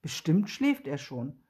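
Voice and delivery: usual speaking voice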